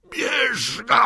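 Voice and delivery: high, very loud drawn-out roar; officer roaring to his men; roared command. A narrator's reading. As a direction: bellow the order at full force. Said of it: "ryknął oficer".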